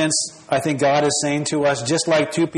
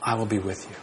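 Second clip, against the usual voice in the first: pastor voicing God's quiet promise to stay near; tender promise; 4 dB quieter than usual. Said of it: "God personally comes to us in Christ and says".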